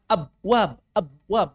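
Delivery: "Abwab" with each b stopped dead; laboured "Abwab", b cut off without echo; without qalqalah. Shown as a demonstration. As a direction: force out "Abwab" with no bounce on the b. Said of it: "ngomong abwab, nggak dikolkolahin".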